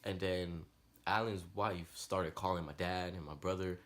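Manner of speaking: usual speaking voice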